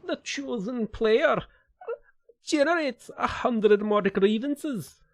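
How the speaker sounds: high, slow, badly faked Scottish accent; hammed-up Scottish proclamation. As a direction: declaim it slowly in a high mock-Scottish voice. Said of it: "I don't know how to do a Scottish accent".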